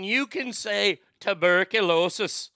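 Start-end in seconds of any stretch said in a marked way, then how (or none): 1.2-2.5 Irish accent. Shown as a demonstration. Host teaches viewers the movie trick, put-on Irish accent. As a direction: draw out "tuberculosis" in mock Irish. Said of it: "you've got your Irish accent".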